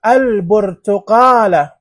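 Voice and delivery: "البرتقالة" slow and loud with a held L; lunar lam, pronounced. Shown as a demonstration. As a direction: spell out "البرتقالة" as al-bur-tu-qaa-la. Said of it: "لام قمرية منطوقة". ("البرتقالة" ببطء بلام ظاهرة)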